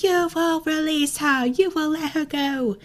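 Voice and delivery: imitating a haughty prince in a high, slow English accent; high and slow, mock-regal English prince; posh English accent. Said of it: "an English accent".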